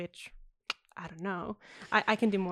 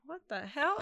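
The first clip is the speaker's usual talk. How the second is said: higher-pitched mock disbelief; incredulous; 7 semitones higher than usual. Actress retelling an old man's odd remark, incredulous. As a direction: retell it as a baffled reaction.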